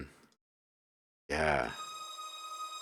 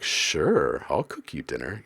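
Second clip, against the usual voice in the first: drawn-out, swooping "sure", slyly agreeable; suspicious-sounding AI; 3 semitones lower than usual. Acted. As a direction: play an AI agreeing with hidden intent. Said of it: "Why'd you say it like that".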